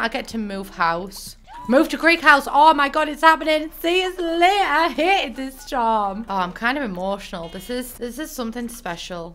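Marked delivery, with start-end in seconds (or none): 1.7-3.7 much higher, louder excited cry; thrilled shout. Real, not acted. Gamer thrilled as her sim moves house. 3.8-6.2 mock farewell. Sim farewell voice.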